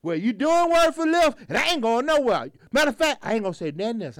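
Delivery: high mock retort, then easing down; hammed-up indignant comeback; old lady won't quit. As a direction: imitate a stubborn passenger arguing. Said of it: "She won't quit".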